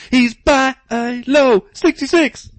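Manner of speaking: all-American hero jingle, hammed up; cartoon theme announcer. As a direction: climb to a loud, high hero call. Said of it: "put that on Cartoon Network".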